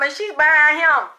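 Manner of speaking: ordinary talk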